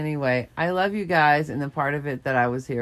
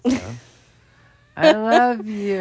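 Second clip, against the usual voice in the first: slow, laughing "I love you"; tender laughing goodbye; 6 semitones higher, 7 dB louder than usual. Real, not acted. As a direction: say it slow and fond. Affectionate goodbye.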